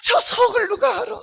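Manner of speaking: high, cheeky puppet voice; ventriloquist puppet voice. Acted. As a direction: tease the crowd as a high little puppet. Puppet teases the congregation. (높고 장난스러운 인형 목소리)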